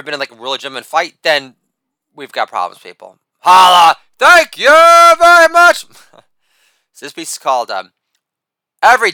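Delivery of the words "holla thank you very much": shouted catchphrase. Acted. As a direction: belt the sign-off like a showman milking applause.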